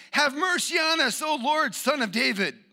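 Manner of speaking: high, loud insistent plea; crying out. Acted. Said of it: "They cried out all the more, saying".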